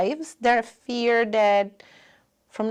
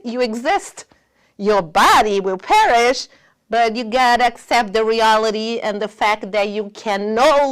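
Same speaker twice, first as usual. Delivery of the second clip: emphatic urging; 8 dB louder than usual. Retold or imitated. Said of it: "I tell this to the teens".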